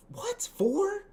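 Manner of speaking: very high squeaky disbelief; incredulous. Real, not acted. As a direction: squeak the question in sheer disbelief. Still stunned by the number.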